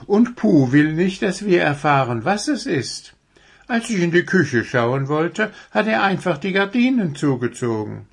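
high, eager deer voice; young deer character. Acted. Deer tells the owl about the dwarf's secret, eagerly. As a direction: explain it excitedly as a little deer.

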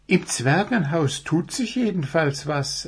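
high, lively deer voice; young deer character. Acted.

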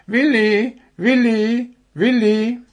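high, loud repeated call. A narrator's reading. Deer wakes the sleeping owl, calling out. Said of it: "Das Reh rief".

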